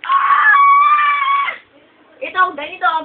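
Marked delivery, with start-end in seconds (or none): 0.1-1.5 very high, loud sustained scream; hammed-up piercing yell; mock scream. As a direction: scream long and high in pretend fury. Screams after threatening to throw something.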